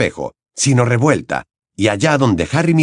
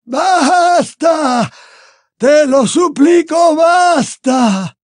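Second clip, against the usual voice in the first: much higher, slow, loud tortured cry; anguished screams; 5 dB louder, much slower than usual. Acted. Dumbledore begging in agony, screaming. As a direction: scream as if burning inside. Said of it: "volvió a gritar".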